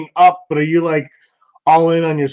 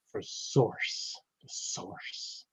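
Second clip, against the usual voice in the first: mock whisper; 5 semitones lower, 20 dB quieter, much slower than usual. Joking whisper about "the source.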